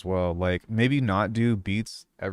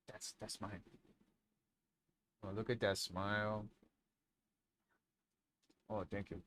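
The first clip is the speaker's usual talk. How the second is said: hushed murmur; 19 dB quieter than usual. Real, not acted. Murmuring to the dog.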